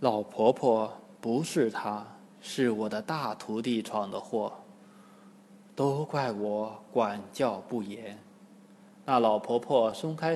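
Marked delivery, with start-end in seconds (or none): 0.0-8.2 much softer and lower; ashamed. A narrator's reading. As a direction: admit the fault in embarrassment. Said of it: "唐僧红着脸说". (羞愧地低声说)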